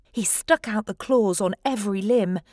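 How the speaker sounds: plain reading voice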